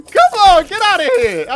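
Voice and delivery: high loud yell at the boss; frantic shout; loud urging. Real, not acted. Boss fight final moments.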